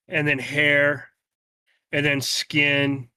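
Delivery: unhurried fantasy narration; story narration. A narrator's reading. GM describes the vision unfolding.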